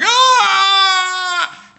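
long, high, loud shriek; hammed-up horror scream; full-throated wail of fright; terrified scream. Tom screams at sock.

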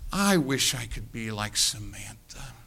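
put-on soft, slow wistful little girl; wistful sighing child. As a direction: sigh it like a dreamy granddaughter. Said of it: "She sighs, and she says".

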